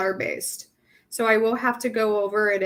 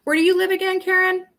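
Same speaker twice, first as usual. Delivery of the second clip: bright curious question; 9 semitones higher, 5 dB louder, faster than usual. Real, not acted. Asking a viewer in chat.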